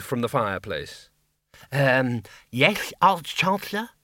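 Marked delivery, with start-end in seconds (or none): 1.7-3.9 quavering. Acted. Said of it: "he quavered".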